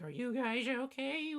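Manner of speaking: lightly voiced old lady checking in; concerned old lady. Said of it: "old lady mom of the group ... is like".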